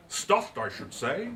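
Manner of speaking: a touch of disgust on stuffed; mild disgust. Acted. Deputy to the group after sniffing the dog, disgusted. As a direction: sniff it out with distaste. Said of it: "fell back with a grunt of disgust".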